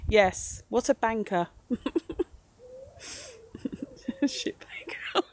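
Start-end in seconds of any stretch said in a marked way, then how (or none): 4.3-5.3 breathless giggle through the words; laughing whisper. Real, not acted. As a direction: barely get it out for laughing. Laughing at spirit box word.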